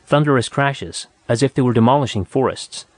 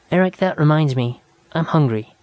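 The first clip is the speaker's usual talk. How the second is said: put-on young woman voice; 4 semitones higher than usual. A narrator's reading. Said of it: "Nada speaking".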